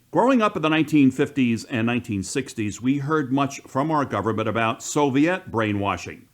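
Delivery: ordinary delivery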